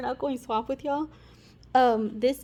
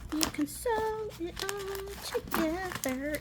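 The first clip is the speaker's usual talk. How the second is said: high, soft, slow sing-song; drawn out high and hushed; 8 semitones higher, 8 dB quieter, much slower than usual. Shown as a demonstration.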